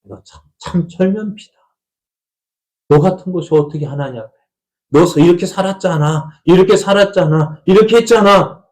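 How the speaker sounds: jab at the listener like the devil poking; mock devil voice building to a jeer; devil's taunting. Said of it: "마귀가 콕콕 찌릅니다".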